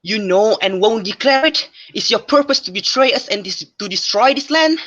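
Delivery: incredulous questioning, rising in pitch; indignant accusation. Acted. King confronting a silent seer about betrayal, indignant.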